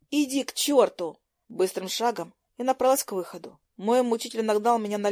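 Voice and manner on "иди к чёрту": higher, slow, emphatic dismissal; angry retort. A narrator's reading. Heroine to Lucas as she walks off, angry. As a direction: send him away angrily.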